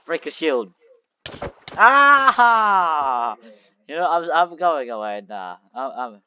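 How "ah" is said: loud dismayed yell. Real, not acted. Shield broken in game.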